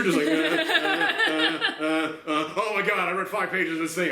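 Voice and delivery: a hint of a flustered dope; mocking stammer. Mocking the character's impossible speed reading, stammering.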